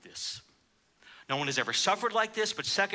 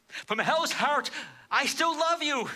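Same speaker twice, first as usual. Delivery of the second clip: climactic cry; 9 semitones higher, slower than usual. Retold or imitated. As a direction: lift it into a soaring, aching declaration. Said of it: "In essence, he says".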